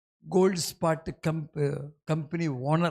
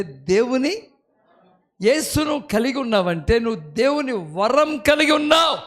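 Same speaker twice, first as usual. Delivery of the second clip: louder, higher, building preacher's cry; preaching at full force; 7 semitones higher, 5 dB louder than usual. Acted. Preacher challenging the congregation with the verse, building loud. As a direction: climb from steady to a ringing challenge.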